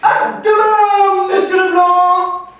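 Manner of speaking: put-on milk the farewell in a booming Belgian accent; loud slow Belgian goodbye. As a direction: caller ends the call in a hammed-up Belgian accent. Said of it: "producteur belge".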